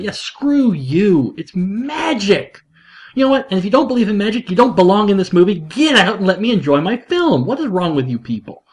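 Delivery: high-pitched, indignant fan rant; mock outrage. Fan telling off the film's critics, mock outrage.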